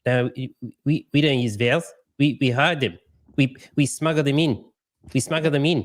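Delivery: mock New Zealander swallowing the vowels; furtive Kiwi caricature with squashed vowels; New Zealand accent. Host playing New Zealanders ashamed of their vowels, New Zealand accent. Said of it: "And then you go to New Zealand".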